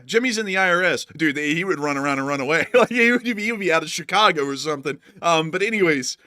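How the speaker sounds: ordinary delivery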